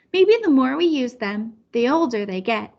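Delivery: ordinary delivery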